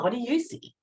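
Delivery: ordinary delivery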